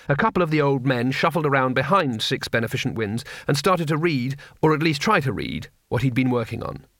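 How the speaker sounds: plain reading voice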